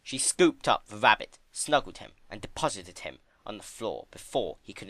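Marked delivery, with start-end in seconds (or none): none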